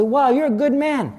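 imitating impressed onlookers; admiring. Quoting admiring people.

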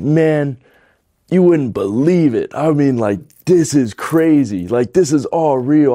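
high, loud, fired-up plea; excited preaching. Retold or imitated. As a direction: hype him up like you just saw a miracle. Preaching to his roommate.

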